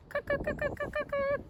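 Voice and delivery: very high, soft clucking cuck; high, soft bird clucks for a picture book; woodpecker call. Acted. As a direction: tap out the calls like a little woodpecker. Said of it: "The woodpecker calls from a tree".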